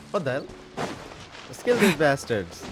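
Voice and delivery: ordinary delivery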